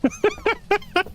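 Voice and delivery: high, bubbling giggle; high-pitched chuckle; real laugh. Real, not acted. Streamer laughs between chat remarks.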